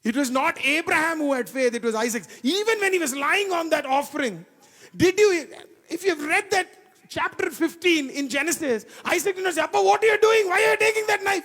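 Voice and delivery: high, loud fervent preaching; preaching at full force. Acted. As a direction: build the church up, full voice.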